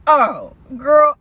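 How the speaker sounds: excited greeting. Retold or imitated. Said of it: "She like".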